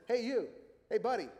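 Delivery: high, loud heckling parrot; parrot calling out. Acted. Parrot hailing a pet store customer, calling out.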